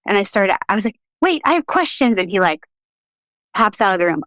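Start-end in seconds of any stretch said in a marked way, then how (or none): 1.2-2.2 higher, mock-urgent plea; urgent call. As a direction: call after him, pitched up. Calling after the vanishing ghost.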